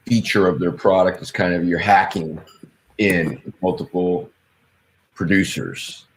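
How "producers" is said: usual speaking voice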